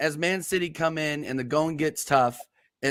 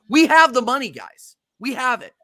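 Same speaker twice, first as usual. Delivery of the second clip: higher, louder insistence, real frustration; getting angry; 8 semitones higher, 4 dB louder than usual. Real, not acted. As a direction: hammer home that the money is there. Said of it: "sorry, guys, I'm getting angry".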